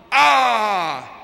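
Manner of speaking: long, high, loud scream; echoed scream. Acted. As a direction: throw the scream back like a mountain echo. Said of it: "hears a voice repeating".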